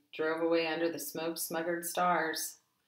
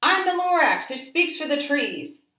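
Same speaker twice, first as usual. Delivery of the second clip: high, loud snapping Lorax; 9 semitones higher, 7 dB louder than usual. A narrator's reading. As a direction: snap it out, the cross little Lorax. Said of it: "He snapped".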